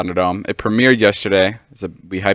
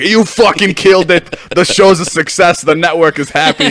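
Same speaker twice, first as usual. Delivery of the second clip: high, loud imitation of a thrilled executive; hammed-up Hollywood phone call; cliche Hollywood exec; 8 semitones higher, 5 dB louder than usual. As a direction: rattle off congratulations like a big-shot producer. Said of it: "the most cliche, like, Hollywood call ever".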